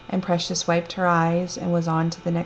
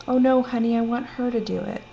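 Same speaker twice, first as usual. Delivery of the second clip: lightly voiced sweet old mother, higher pitched; a hint of a fond old lady insisting; 6 semitones higher than usual. Old mother telling her daughter to let the narrator do it, lightly voiced sweet insistence.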